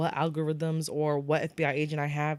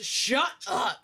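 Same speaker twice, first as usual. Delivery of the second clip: high, emphatic mock "shut up"; acting out an exasperated interruption; retold angry outburst; 6 semitones higher than usual. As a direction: cut him off dramatically, pitched up. Retold quarrel, she cuts him off.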